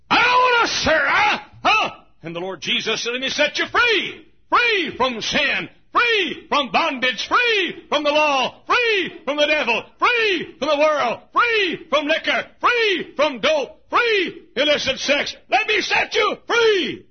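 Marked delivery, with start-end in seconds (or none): 0.1-2.0 high, loud, scornful refusal; a sinner shouting at the Lord; defiant shout. Acted. Sinner defying Jesus. 3.1-17.1 fiery preacher's climax; preaching at full force. Acted. Preaching climax, Jesus' offer.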